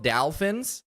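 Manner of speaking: "dolphins" said as "dalfins"; first vowel of "dolphins" turned to a as in Al; mispronounced dolphins. Retold or imitated. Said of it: "saying words wrong".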